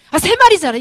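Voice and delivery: very loud, high outburst; angry older brother. Acted. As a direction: explode in exasperation at your brother. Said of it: "머리 끝까지 화가 납니다". (형이 화나서 버럭 소리치듯)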